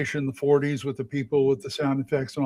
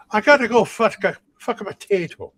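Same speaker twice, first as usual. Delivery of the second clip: stage Irish; 6 semitones higher than usual. Retold or imitated. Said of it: "what I call is".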